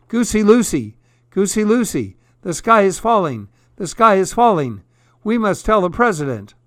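high, urgent storybook yelling; alarmed yell. A narrator's reading. Hen and duck run to their goose friend, yelling in alarm. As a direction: call out the panic to a friend. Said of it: "and yelled".

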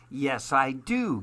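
usual speaking voice

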